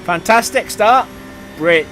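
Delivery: loud, high sarcastic shout. Real, not acted. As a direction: yell it with bitter irony. Start goes badly.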